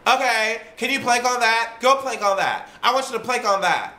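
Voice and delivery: put-on high, teasing girly voice; mimicking the women goading their friend, high and sing-song; mocking giggly women. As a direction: imitate the women egging her on. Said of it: "and they're like".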